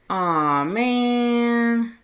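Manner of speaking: put-on a disappointed child groaning at the crowd; kid's loud, high "aw man"; complaining kid. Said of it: "one of the kids in the crowd complained".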